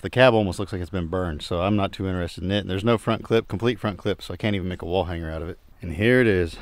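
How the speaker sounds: ordinary delivery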